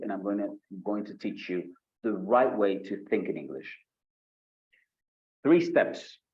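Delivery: ordinary talk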